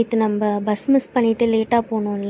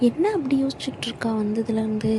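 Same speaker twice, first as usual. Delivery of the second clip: ordinary talk, nothing marked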